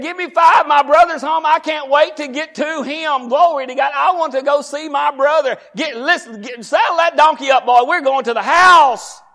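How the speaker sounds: high, loud joyful call; excited shouting brother. Acted. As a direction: holler for the donkey, bursting with joy. Voiced brother calling out excitedly.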